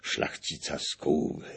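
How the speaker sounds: ordinary delivery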